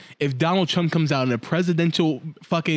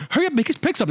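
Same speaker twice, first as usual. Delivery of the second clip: high-pitched kid voice, mildly mocking; bratty kid; 10 semitones higher, faster than usual. Kid teammate nagging him to hurry, squeaky mock. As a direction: imitate a pushy twelve-year-old. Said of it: "this one kid was like".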